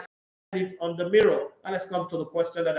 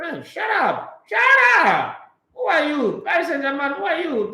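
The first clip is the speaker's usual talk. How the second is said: high, loud yell of shut up; real angry outburst at the rival club; angry shout; 8 semitones higher, 4 dB louder than usual. Real, not acted. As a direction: shout them down, fed up. Angrily telling rivals off.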